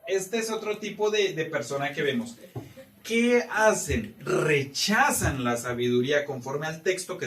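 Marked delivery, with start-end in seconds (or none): 3.0-4.2 slow, drawn out and louder; rhetorical question. Real, not acted. Teacher asking the class, slow and raised.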